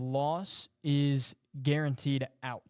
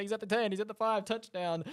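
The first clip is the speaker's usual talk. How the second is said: auctioneer voice; 6 semitones higher than usual. Shown as a demonstration. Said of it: "bring your auctioneer voice out".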